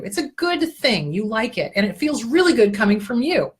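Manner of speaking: ordinary talk, nothing marked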